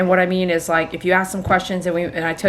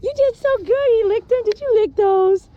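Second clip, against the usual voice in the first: talking to a dog; 10 semitones higher than usual. Real, not acted. Groomer praising dog.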